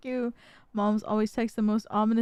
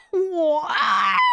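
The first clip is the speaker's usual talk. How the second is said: higher, drawn-out shocked yelp; freaking out; 3 semitones higher, 4 dB louder than usual. Retold or imitated. Daughter reacting to mom's car fire text, freaking out. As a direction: retell a stunned cry of disbelief. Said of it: "freaking out".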